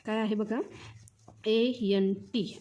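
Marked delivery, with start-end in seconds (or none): 1.5-2.6 slow, separate letters, each one clear; spelled out letter by letter. Shown as a demonstration. Teacher spelling out a word for young students, slow and clear. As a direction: spell it slowly for the class. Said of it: "ॲन्ट म्हणजेच काय रे?".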